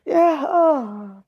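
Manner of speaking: ordinary delivery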